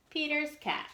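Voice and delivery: usual speaking voice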